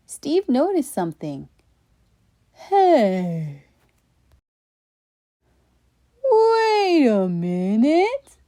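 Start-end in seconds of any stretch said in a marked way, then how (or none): 2.5-8.4 sudden realization. Acted. Steve realizes something.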